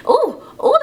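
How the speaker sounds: high, loud surprised ooh; surprised squeal. Real, not acted. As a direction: yelp at her own voice crack. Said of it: "hear that voice crack?".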